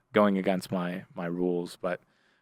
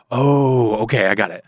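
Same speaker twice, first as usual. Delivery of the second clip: sudden realization; 3 semitones higher, 8 dB louder than usual. Retold or imitated. Coworker catching on to the ruse, loud knowing realization. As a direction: play the penny dropping, loudly.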